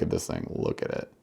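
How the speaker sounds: hushed look at it; whispered awe; awed whisper. Real, not acted. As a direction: whisper it in admiration. Final close-up of the jig.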